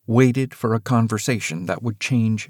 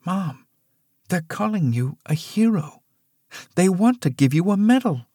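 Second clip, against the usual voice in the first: a touch of a shaky, higher plea; voice shaking; 9 semitones higher than usual. Acted. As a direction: hold back tears lightly. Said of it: "Eleanor's voice shook".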